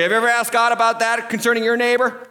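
usual speaking voice

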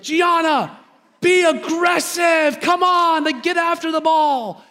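high, drawn-out urging from the touchline; sideline parent yell. Retold or imitated. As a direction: re-enact an overzealous parent hollering at a child mid-game.

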